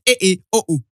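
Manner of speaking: loud exaggerated Nigerian accent exclamations; mock Nigerian accent. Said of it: "you always sound like".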